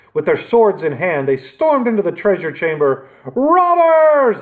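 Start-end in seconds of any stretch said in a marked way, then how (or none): 3.3-4.4 high, loud alarm cry. A narrator's reading. Knights storm in on the robbers, crying out loud and high. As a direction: raise the alarm. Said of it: "they cried".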